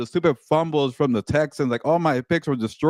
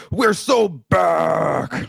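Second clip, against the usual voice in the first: slow, drawn-out, higher "We're so back!" with each word stretched; hyped announcer call; 6 semitones higher, 3 dB louder, much slower than usual. Retold or imitated. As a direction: boom it into the microphone like a wrestling promo. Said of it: "I wanted to take the microphone and be like".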